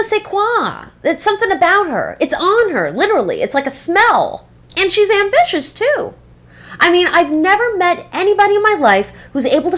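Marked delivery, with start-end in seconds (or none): none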